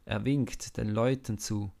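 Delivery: plain reading voice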